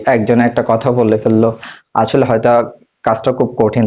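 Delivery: usual speaking voice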